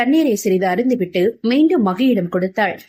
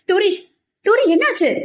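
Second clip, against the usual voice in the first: high-pitched panicked call; alarmed; 11 semitones higher than usual. A narrator's reading. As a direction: call her name in rising alarm. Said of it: "என பதறியவாறு".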